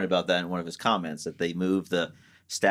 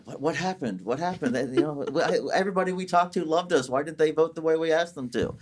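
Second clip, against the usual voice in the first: bewildered Hollywood star; 8 semitones higher than usual. Retold or imitated. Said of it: "all these Hollywood stars going".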